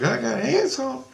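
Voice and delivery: put-on very high, slow child voice; twelve-year-old girl. Little girl learns she has ants, high child voice. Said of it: "she talking like she's twelve years old".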